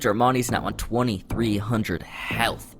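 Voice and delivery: ordinary talk, nothing marked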